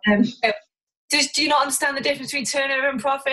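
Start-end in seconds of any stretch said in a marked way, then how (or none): 1.1-3.3 quick, higher-pitched imitation of a critic; mock condescending. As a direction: rattle it off like a know-it-all challenger. Voicing a condescending online critic.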